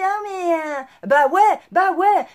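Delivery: imitation moqueuse d'une excuse molle, plus haut et plus fort; excuse imitée. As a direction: singer les gens qui se défilent. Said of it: "et puis après c'est". (imitation moqueuse de ceux qui laissent tout passer)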